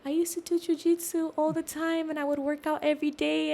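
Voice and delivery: light up talking about what you love; lit up. Retold or imitated. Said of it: "I just, like, lit up".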